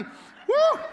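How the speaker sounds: squealing high whoop breaking into a laugh. Retold or imitated. Preacher laughing at his own past panic, high squealing whoop. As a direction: let out a laughing yelp.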